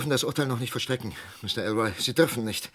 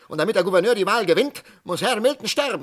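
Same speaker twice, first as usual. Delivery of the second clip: fast, higher, bitter accusation; 7 semitones higher, 4 dB louder, faster than usual. Acted. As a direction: spit the charge out quickly. Arzt wirft dem Gouverneur Wahlkalkül vor. (schnell, höher, bitter anklagend)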